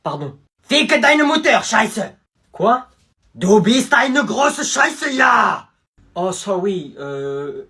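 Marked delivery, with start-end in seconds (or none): 0.6-5.7 loud, slow German shouting; hammed-up German tourist yelling; mock German. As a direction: rant in harsh German.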